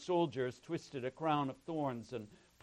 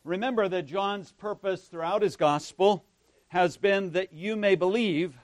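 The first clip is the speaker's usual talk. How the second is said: loud, higher-pitched projected sermon voice; preaching; 5 semitones higher, 14 dB louder than usual. Real, not acted. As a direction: preach it out forcefully to the congregation.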